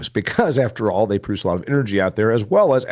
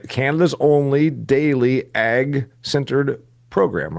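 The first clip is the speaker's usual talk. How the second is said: slow, spaced-out radio promo line; measured promotional read; promo tagline; 3 semitones higher, much slower than usual. Retold or imitated. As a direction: quote the slogan like an announcer, drawn out. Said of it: "what you say in your promotion".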